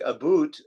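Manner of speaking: "about" stretched into "a boot"; exaggerated Canadian about. Shown as a demonstration. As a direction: overdo "about" with a long "oo". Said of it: "exaggerate it, like".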